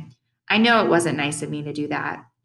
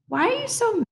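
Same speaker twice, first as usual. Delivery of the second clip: put-on extremely high, squeaky child voice; kid's pleading question. A narrator's reading. Boy asking his angry babysitter, very high child voice. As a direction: ask in a tiny high voice.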